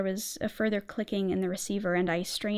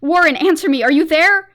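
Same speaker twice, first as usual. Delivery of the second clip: loud, high frantic scream; frantic shouting for a lost friend; 7 semitones higher, 14 dB louder than usual. Acted. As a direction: yell desperately down the line. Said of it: "and screamed".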